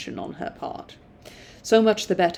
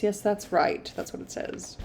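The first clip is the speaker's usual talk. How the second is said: ordinary delivery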